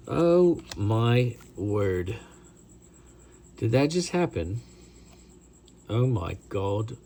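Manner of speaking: drawn-out, slow mock-British "Oh my word"; fake British accent. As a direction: gasp it like a hammy fake Brit. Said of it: "my crap fake British voice".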